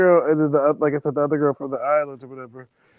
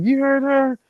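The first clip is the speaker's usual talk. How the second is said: mimicking the older lady; 10 semitones higher, slower than usual. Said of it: "she was like".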